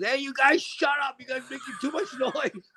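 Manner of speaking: high-pitched mock telling-off, without raising the volume much; complaining old lady. Old lady near the park telling off noisy men, high complaining voice. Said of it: "This old lady comes out".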